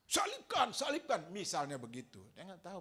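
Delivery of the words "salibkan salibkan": very high, loud mock crowd shout; crowd yelling. Crowd demanding crucifixion, loud high mock yell. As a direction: bellow the demand like an angry mob. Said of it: "dia paling teriak. Kencang".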